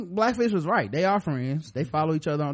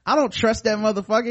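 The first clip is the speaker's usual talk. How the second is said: mock indignant distrust; 6 semitones higher, 5 dB louder, faster than usual. Acting out a distrustful refusal.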